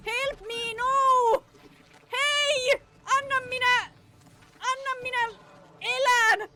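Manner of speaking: high, loud desperate begging; frantic pleading. Real, not acted. Player begging the monster to spare him, high and frantic. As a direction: plead for your life in a panic.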